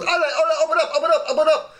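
very high-pitched, comic urgent command; hurried guard imitation. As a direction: mimic an excited guard in a squeaky high voice. Retold guard urgently waving them through.

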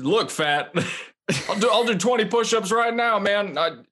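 mock Joe Biden snapping back. Friend imitates Joe Biden answering a heckler at a caucus. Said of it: "my Biden voice".

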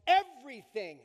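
"everything" said loud with a falling end; loud, in caps. Shown as a demonstration. Pastor to congregation, "everything" shouted out in caps. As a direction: belt out "everything" as if in capitals with an exclamation mark. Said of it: "In caps with an exclamation mark".

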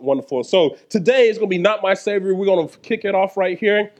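ordinary talk, nothing marked

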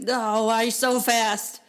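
loud, drawn-out pretend amazement; mock amazement. Parent greeting a child back from a timed errand, mock amazement. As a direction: play amazed, stretching it out slowly.

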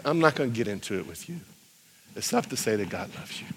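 low, hushed, compassionate voice; soft and sincere, with pauses; tender sympathy. Retold or imitated. Evangelist consoling a hurt student, tender sympathy. Tells hurting man he feels his pain.

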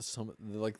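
ordinary delivery

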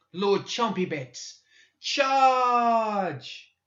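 high, drawn-out battle cry; child commander sounding the charge; shouted. A narrator's reading. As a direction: shout the order slowly, ending on a long high holler. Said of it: "and shouted".

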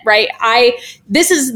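ordinary delivery

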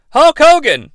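usual speaking voice

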